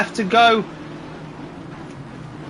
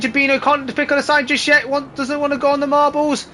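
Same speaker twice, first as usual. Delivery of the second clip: rising live race call; excited play-by-play, pitch lifting; motorsport commentator; 5 semitones higher than usual. Acted.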